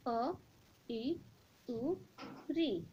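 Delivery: अ, ई, उ, री said short and crisp; short vowels. Shown as a demonstration. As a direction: clip each vowel अ ई उ री quickly. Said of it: "फिर से एक बार उच्चारण करें". (ह्रस्व स्वर छोटे उच्चारण में)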